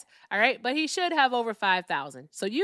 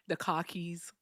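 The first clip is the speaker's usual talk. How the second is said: R-less Boston accent; 2 semitones lower, 5 dB quieter, slower than usual. Shown as a demonstration. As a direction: drop the R like a Bostonian. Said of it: "here's my Boston accent".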